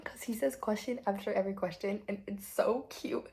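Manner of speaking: usual speaking voice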